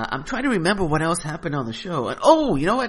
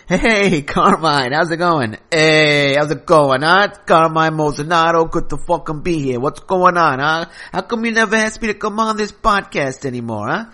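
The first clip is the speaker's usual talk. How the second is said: loud, higher tough-guy New York mobster voice; Carmine the caller; 4 semitones higher, 7 dB louder than usual. Acted. Loud pushy mobster caller picking a fight with the host. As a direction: barge in as a pushy wiseguy.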